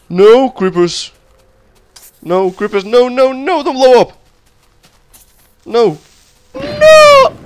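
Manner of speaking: high, loud panicked screams of no; begging the creeper not to explode; real panic, voice cracking high; desperate pleading. Real, not acted. Creeper about to blow up.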